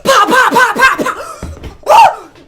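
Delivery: high, loud popping shots; gunshot imitation. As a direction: spit out the pops like gunfire.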